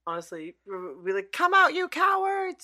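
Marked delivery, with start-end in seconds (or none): 1.3-2.6 high, loud, drawn-out taunting yell; mock challenge. Friend daring graveyard vampires to appear, mock yell. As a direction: holler a playful dare across a graveyard.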